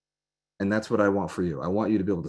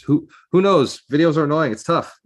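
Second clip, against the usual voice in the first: louder rallying; 7 semitones higher, 7 dB louder than usual. Real, not acted. Rallying live viewers.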